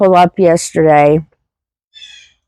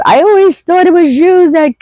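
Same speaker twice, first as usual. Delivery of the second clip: imitating her friend's mother; 12 semitones higher than usual. Friend's late mother apologizing to her, much higher put-on voice. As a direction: play the mother making her apology, pitched way up. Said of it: "And she says".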